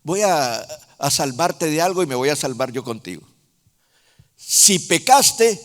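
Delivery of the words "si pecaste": louder, higher, slow and blunt; stern plain accusation; blunt version. Shown as a demonstration. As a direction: hit it hard and slow. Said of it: "bueno, digámoslo bonito".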